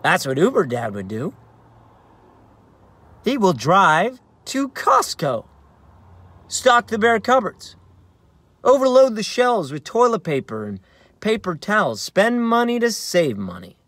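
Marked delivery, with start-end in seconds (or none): 3.2-13.8 grand heroic plan. Acted.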